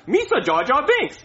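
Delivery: high put-on goofy alien voice; Jar Jar Binks. Shown as a demonstration. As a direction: show off a quick film character impression. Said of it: "I can imitate any character".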